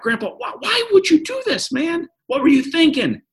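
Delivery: high-pitched mock yell at grandpa. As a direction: squeal it way up high, half joking. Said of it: "yelled back".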